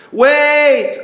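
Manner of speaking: sudden high call to stop them; urgent cry. A narrator's reading. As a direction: cry out to halt the step. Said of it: "cried another chicken".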